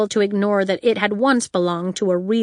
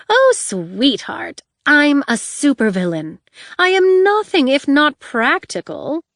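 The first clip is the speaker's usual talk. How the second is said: diva villain voice; 6 semitones higher, 4 dB louder than usual. Acted. Honey, flamboyant supervillain voice.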